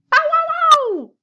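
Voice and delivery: loud, very high barking dog. Acted. Dog barking at the moving mitten, loud and high. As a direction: bark it out sharp and loud. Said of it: "went the dog".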